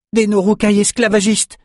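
high-pitched cry of warning; alarmed cry. Acted. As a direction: cry out the enemy's name in alarm. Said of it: "cria Bannon".

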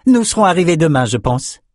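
quick, high-pitched announcement; young scholar character. Acted.

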